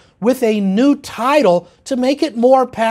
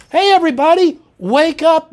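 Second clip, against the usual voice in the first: raised, higher call to everyone; 6 semitones higher, 3 dB louder than usual. Real, not acted. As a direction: rouse the whole audience. Calls out to audience.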